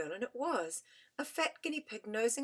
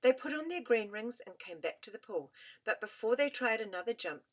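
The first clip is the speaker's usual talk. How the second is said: plain reading voice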